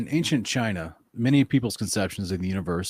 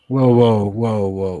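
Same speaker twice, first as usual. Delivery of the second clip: loud; 8 dB louder, much slower than usual. Real, not acted. Stopping for a mock accent.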